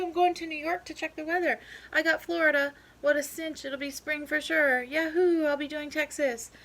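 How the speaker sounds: high, loud eager chatter; excited graduates. Acted. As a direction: rattle off plans, thrilled. Graduates leave for posts.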